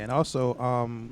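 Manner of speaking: usual speaking voice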